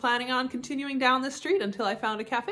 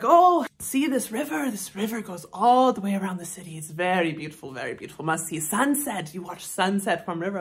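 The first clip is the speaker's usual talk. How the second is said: mock Thai accent; 2 semitones lower than usual. Local man describing the river to a tourist, mock Thai accent. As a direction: play a Thai local praising the sights. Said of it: "go into a bit of an accent".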